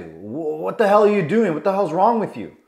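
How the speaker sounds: acted scolding, high and heated; angry dad. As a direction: fire off the questions in frustration. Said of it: "what does dad do?".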